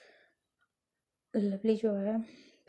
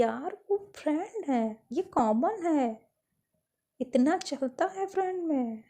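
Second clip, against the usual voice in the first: teasing dismissal; 5 semitones higher, 3 dB louder, slower than usual. Acted. Friend brushing off jealousy.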